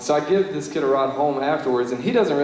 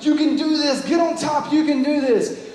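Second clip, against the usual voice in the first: cheering yell; 11 semitones higher than usual. Retold or imitated. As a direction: yell encouragement up the pole. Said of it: "Her whole cabin's down there yelling for her".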